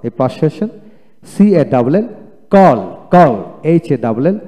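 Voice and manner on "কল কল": কল loud and distinct, twice; model reading. Shown as a demonstration. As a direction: drill কল loudly with an open "aw". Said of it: "আমি এখন পড়ে শোনাই".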